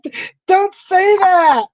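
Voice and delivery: high, slow, spooked please-stop; begging the teasing friend to stop, high and slow. Retold or imitated. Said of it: "I'm like".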